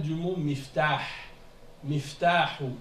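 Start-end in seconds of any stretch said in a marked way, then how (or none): none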